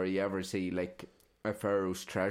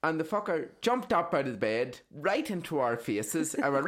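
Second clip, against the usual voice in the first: ordinary talk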